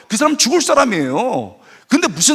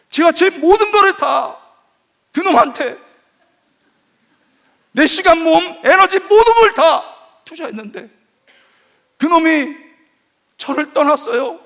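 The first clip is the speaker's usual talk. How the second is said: put-on high, louder, slowed complaint of a jilted girlfriend; hammed-up tearful rant; imitating upset young woman; 8 semitones higher, 5 dB louder than usual. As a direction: wail it to the pastor like a dumped girlfriend. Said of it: "울면서 막 저한테 소리 질렀어요".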